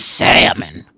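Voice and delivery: mock waitress voice, strange and strained; mocking the waitress's voice. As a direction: imitate her odd rasp, mocking. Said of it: "That was her voice".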